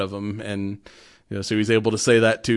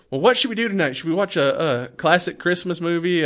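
higher, lightly voiced Mrs Claus; mock Mrs Claus; 6 semitones higher than usual. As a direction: lift it up as a cheery Mrs Claus. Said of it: "She's like".

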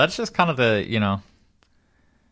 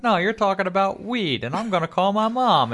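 put-on high kid voice; 11 semitones higher than usual. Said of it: "He's like".

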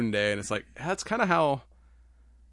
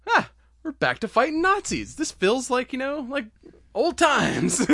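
much higher, cheery put-on voice; high mock voice; 13 semitones higher, 5 dB louder than usual. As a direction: squeak it out like a giddy fan. Voicing a fan's reaction.

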